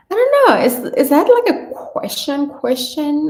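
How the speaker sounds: high, loud and puzzled; bewildered high-pitched reaction; baffled. Real, not acted. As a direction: squeak out confusion at the comment. Baffled by comment.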